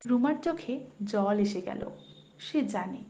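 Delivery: ordinary delivery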